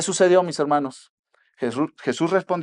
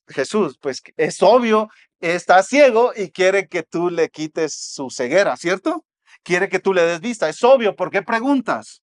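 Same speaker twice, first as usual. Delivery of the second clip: incredulous protest; 4 semitones higher, 5 dB louder than usual. Retold or imitated. Preacher voicing the blind man asking Jesus why he even asks, incredulous. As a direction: act it out as if the answer were painfully obvious. Blind man's imagined puzzled reply.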